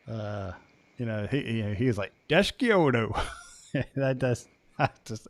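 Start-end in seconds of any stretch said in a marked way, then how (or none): 2.3-3.2 put-on garbled thick Cajun-coach accent, pitched up; mock thick Louisiana coach accent; Ed Orgeron's thick accent. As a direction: imitate a thick Louisiana accent, words mashed together. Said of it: "he has a really thick accent".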